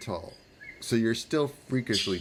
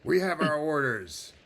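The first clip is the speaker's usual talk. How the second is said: high-pitched, officious dismissal; unbending guard; 6 semitones higher than usual. Acted. Guard refusing the tired traveler, unbending. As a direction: send them off firmly.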